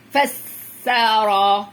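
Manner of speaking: فسر with a long hissed س, then loud held vowels; teacher stretching every syllable of فسر; drawn-out syllables. Shown as a demonstration. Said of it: "واحدة سكون".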